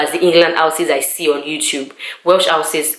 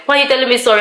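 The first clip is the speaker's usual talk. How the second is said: higher-pitched retold objection; serious challenge; 7 semitones higher, much faster than usual. Colleague repeating her objection to sympathy, retold serious challenge. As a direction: question the apology as if it really matters. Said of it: "she took it seriously".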